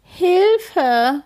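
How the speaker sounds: put-on scared child's rising cry; cry for help. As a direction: try to call out in panic. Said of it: "will Kim rufen".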